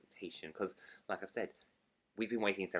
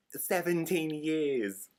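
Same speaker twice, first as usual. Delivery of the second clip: "Seventeen years" drawn out high and loud; "Seventeen years" slow, loud and heavily stressed; emphatic; 7 semitones higher, 6 dB louder, much slower than usual. Real, not acted. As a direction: stretch out "Seventeen years" with exasperated force. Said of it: "I'm stressing this again".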